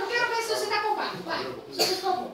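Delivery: ordinary talk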